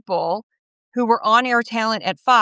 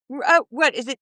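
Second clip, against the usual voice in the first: normal talking voice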